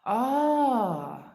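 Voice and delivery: high loud anguished ah; longing cry. Real, not acted. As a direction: cry out with craving. Overwhelmed by craving.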